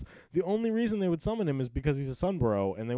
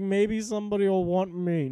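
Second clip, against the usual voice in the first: hopeful whine; 6 semitones higher than usual. Retold or imitated. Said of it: "I was like".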